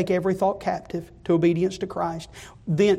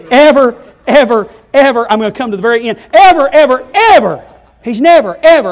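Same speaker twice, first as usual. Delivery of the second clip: loud, high shouted evers; preaching at full force; 6 semitones higher, 14 dB louder than usual. Acted. Preacher to congregation, full-force shouted evers. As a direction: preach it to the rafters.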